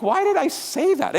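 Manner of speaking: be baffled at yourself; mock bewildered. Self-questioning acted.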